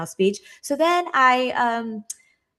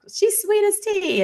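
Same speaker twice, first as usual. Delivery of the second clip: a slow, high Southern accent showing off the drawl; sweet Southern accent, raised high and slowed down; 12 semitones higher, slower than usual. Shown as a demonstration. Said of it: "I'll say something very Southern".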